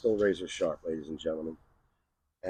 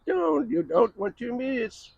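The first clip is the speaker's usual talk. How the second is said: teasing sing-song. Acted. Teasing viewers about upcoming video.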